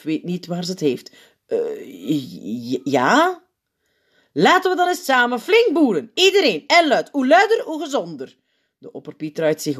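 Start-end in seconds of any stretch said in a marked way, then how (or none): 1.5-3.4 halting, unsure, rising into a question; baffled, stuttering reply; flustered stammer. A narrator's reading. Flustered teacher stammers an answer. 4.3-8.4 high, loud, gleeful rallying; rousing call. A narrator's reading. Sint rallies class to burp loudly.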